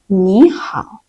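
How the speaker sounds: 你好 modelled carefully for the class; model Chinese greeting. Shown as a demonstration. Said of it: "Let's say together".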